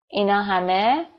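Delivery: ordinary delivery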